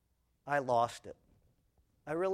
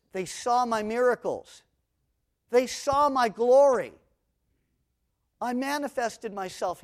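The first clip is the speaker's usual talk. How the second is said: rising indignation; 9 semitones higher, 10 dB louder than usual. Acted. Voicing God's complaint, building.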